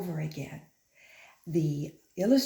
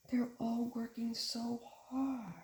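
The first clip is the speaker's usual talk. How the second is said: gasping, slow, softer line; breathless and soft; 5 semitones higher, 9 dB quieter than usual. Acted. Teacher marveling at the pulling, breathless and soft. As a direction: sound worn out from pulling. Gasp, tired story moment.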